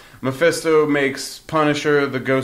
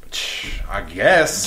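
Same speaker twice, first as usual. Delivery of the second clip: slow, lower, grudging; reluctant shrug; 7 semitones lower, 3 dB quieter, much slower than usual. Retold or imitated. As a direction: drag it out like you barely care. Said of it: "And he's like".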